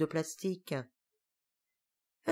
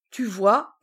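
exasperated; 3 semitones higher, 7 dB louder than usual. Acted. Griselle to cousin.

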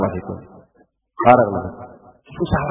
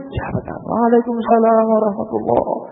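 fervent preaching; 7 semitones higher than usual. Acted. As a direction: press the point with rising zeal. Preacher at full force.

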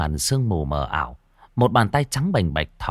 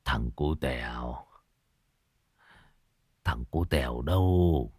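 put-on low, very soft, slow old man; feeble, hushed voice dragging the words; weak murmur; 7 semitones lower, 6 dB quieter than usual. A narrator's reading. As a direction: ask faintly, drained and low. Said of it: "thì thào".

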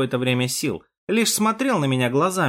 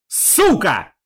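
louder, higher burst of rage; angry curse; 6 semitones higher, 5 dB louder than usual. A narrator's reading. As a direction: curse in bitter fury. Герой в ярости над погибшим. (злое ругательство)

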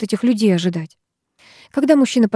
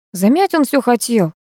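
ordinary delivery, nothing marked

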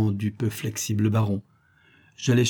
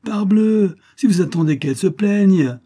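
higher, louder sudden exclamation; indignant outburst; 9 semitones higher, 6 dB louder than usual. A narrator's reading. Nephew to his grandfather-uncle, a loud higher exasperated outburst. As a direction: burst out to shake the stubborn uncle.

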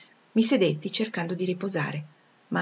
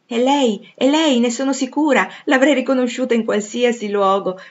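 loud, high cry of recognition; excited exclamation; 6 semitones higher, 8 dB louder than usual. A narrator's reading. A stranger recognises Jane at last, exclaiming excitedly. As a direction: exclaim it, thrilled and certain. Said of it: "esclamò la sconosciuta".

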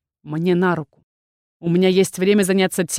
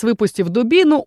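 plain reading voice

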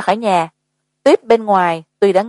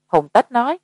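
ordinary delivery

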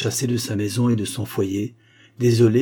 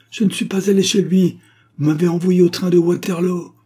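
loud, high, heated denial; vehement protest; 9 semitones higher, 7 dB louder than usual. Acted. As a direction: protest at the top of the register. Rosa hotly protests the denial.

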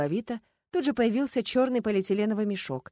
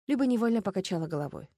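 plain reading voice

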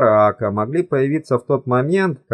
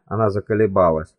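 plain reading voice

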